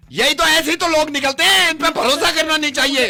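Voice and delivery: very high, loud angry yell; shouting. Acted. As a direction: shout him down. Phone पर चीखता हुआ झगड़ा. (गुस्से में ज़ोर से चीखते हुए)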